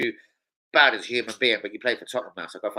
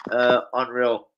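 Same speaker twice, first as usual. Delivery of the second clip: usual speaking voice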